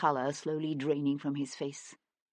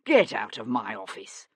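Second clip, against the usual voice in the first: low, voiced order, leaning on get; raw anger; 6 semitones lower than usual. A narrator's reading. Carmichael, enraged, orders Sebastian out in a low voice. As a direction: order him out, barely holding it in. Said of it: "his voice shaking with raw anger".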